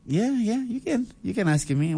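a lightly voiced dad saying go ahead; father's voice. Father answers son in joke.

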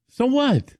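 high defiant so what. Real, not acted. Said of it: "I, I cry".

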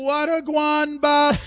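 very loud, slow, high Jamaican squawk; Jamaican parrot. Acted. Parrot hailing [name], high slow Jamaican squawk. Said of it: "Did he have a Jamaican accent?".

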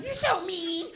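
very high, whiny mock falsetto; annoying girl voice. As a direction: whine it like an exaggerated girlfriend. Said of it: "That voice is annoying".

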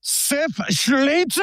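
put-on Schlitter said slow and high in a strong German accent. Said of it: "I've got to say it with a very strong German accent".

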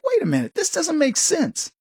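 high, slowed, baffled voice; puzzled disbelief. Retold or imitated. Jillian Michaels doubting her state's election, high baffled disbelief. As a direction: act out her double take at what she saw. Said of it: "She was like".

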